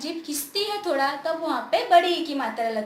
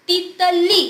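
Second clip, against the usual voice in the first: तितली with a loud, long ली; forceful last syllable; 3 semitones higher, 10 dB louder, much slower than usual. Shown as a demonstration. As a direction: push hard on the ली of तितली. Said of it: "जोर से बोला".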